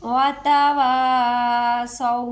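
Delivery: slow, drawn-out tajweed chant; Quran recitation. Shown as a demonstration. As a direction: recite it like a qari, stretching each sound. Recitation demo. (धीमी तजवीद वाली तिलावत)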